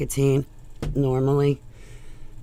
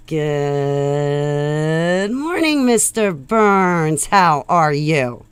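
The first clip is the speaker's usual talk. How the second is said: slow, louder drawn-out good morning; put-on greeting voice; 4 dB louder, much slower than usual. Greeting Mister Burns cheerfully, slow and drawn out. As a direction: stretch the greeting out in a sung voice.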